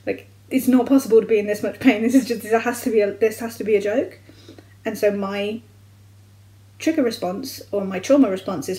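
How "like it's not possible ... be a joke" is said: louder, incredulous inner voice, building to exasperation; disbelieving outburst. Retold or imitated. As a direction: shout it as if it can't be real. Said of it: "my brain just goes".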